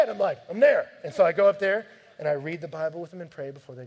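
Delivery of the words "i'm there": calling back. Retold or imitated. Answering the kids' call.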